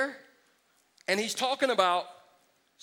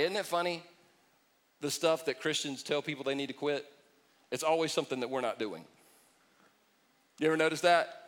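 usual speaking voice

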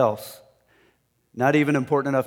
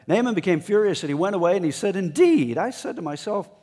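loud, high angry outburst; furious; 7 semitones higher, 5 dB louder than usual. Acted. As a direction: let the fury burst out as Naaman. Retelling Naaman's rage loudly.

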